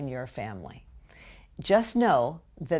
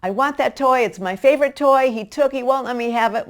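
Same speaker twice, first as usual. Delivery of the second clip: put-on much higher, louder whiny child complaint; upset child; 7 semitones higher, 9 dB louder than usual. Child complains to parent that his toy was taken, whiny. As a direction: whine like a wronged little kid. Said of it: "What's going on?".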